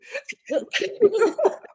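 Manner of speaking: high, loud burst of laughter. Real, not acted. Laughing at the memory.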